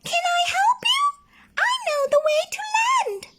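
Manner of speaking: very high, fast bird chirp. A narrator's reading. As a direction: chirp eagerly. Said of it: "she chirped".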